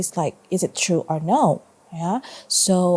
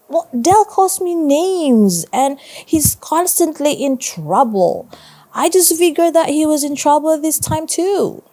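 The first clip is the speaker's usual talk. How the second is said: put-on higher kid voice, defensive and pleading; 9 semitones higher, 5 dB louder than usual. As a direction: play the boy making excuses, pitched up. Boy defends himself to teacher.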